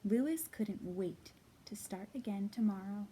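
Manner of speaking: put-on lively read-aloud to children; storybook reading. A narrator's reading. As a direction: read it like story time for little kids. Reads a picture book aloud.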